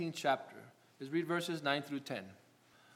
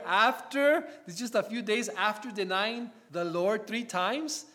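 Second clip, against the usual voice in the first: higher, louder rising sermon delivery; preacher lifting the congregation toward the ascension; preaching at full force; 4 semitones higher, 3 dB louder than usual. Acted. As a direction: build the sermon up with fervour.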